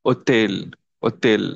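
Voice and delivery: silent H in Hotel, "o-tel"; Hotel with the opening H dropped; French pronunciation. Shown as a demonstration. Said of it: "silent గానే పలకాలి".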